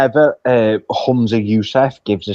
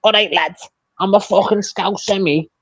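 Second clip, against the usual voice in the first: higher, mocking Scouse; hammed-up Scouse accent; 7 semitones higher than usual. Politician appealing to the working class, hammed-up Scouse. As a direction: ham up the Scouse like you're one of the lads. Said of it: "he hams up this Scouse accent".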